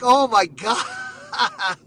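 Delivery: drawn out, broken up by real laughter; slow, laugh through the words; laughing oh my God. Real, not acted. Friend retelling a wrestler's roast to his buddy, laughing hard.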